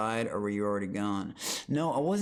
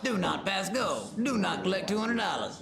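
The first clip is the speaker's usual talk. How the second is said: nonchalant commentator; 8 semitones higher than usual. Retold or imitated. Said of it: "So nonchalant".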